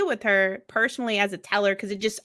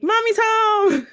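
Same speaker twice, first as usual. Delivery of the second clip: much higher, slow and louder, mock-excited shout; imitating excited teen; 12 semitones higher, 6 dB louder, slower than usual. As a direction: play the big kid gushing that mom's home. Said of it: "even he was like".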